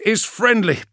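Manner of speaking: high, loud pilot voice; a crisp pilot calling in from the cockpit; gunship pilot. Acted. Pilot replies over the vox.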